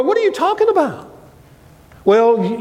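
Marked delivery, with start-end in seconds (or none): 0.0-1.1 high, puzzled question; lightly voiced bewildered friend; mock confusion. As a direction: sound baffled. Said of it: "they may go".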